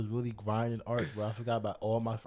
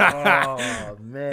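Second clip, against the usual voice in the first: loud laughing exclaim; 2 semitones higher, 13 dB louder than usual. Real, not acted. Hard cookie choice amuses him.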